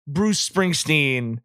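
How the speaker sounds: ordinary delivery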